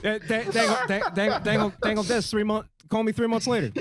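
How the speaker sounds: Boomhauer. Acted. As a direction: stammer out run-on Texan nonsense. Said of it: "I'm doing Boomhauer".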